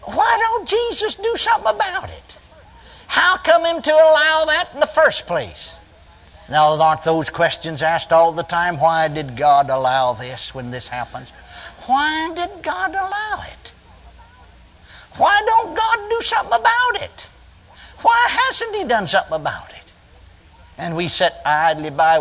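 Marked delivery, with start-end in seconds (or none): 0.0-5.8 very high, querulous put-on voice; mock whining complaint. Preacher retelling the thought that entered his mind, high mock whine. 11.8-19.9 thin, very high complaining voice; mock whining questioner. Preacher mimicking those who ask why God allows this, high mock whine.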